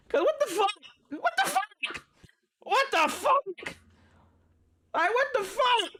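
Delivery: high, incredulous what the fuck; exasperated disbelief. Real, not acted. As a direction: throw it up in disbelief. Exasperated repeated outburst.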